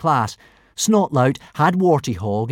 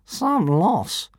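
high, sliding scornful voice; sneering; 9 semitones higher than usual. A narrator's reading. Dragon scoffing at the lost dragon, sneering. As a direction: sneer it dismissively. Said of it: "sneered Fireworm".

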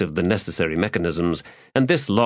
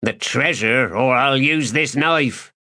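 menacing threat; 5 semitones higher than usual. Acted. Thief threatening with knife.

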